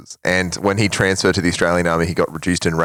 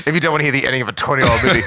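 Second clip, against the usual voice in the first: fast, high mocking echo; mocking imitation; 5 semitones higher, much faster than usual. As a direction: parrot it back teasingly. Mocking friend's warning.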